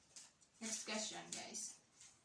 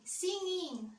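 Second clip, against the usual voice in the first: bright burst, pitched up and louder; excited answer; 8 semitones higher, 6 dB louder than usual. Real, not acted. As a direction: blurt it out eagerly. Answering singing or dancing.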